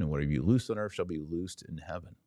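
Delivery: normal talking voice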